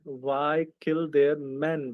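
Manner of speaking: ordinary talk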